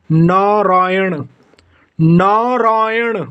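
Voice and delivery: नारायण drawn out syllable by syllable, very slowly; slow spelling-out demo. Shown as a demonstration. Teacher to students, slowly dictating नारायण syllable by syllable. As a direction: stretch नारायण slowly into its syllables as you would for a class. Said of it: "यानी कि अशुद्ध और शुद्ध".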